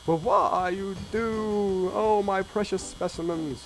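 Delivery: high, slow distressed voice; a lightly voiced fussy scientist; whiny scientist voice. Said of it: "the voice will just change again".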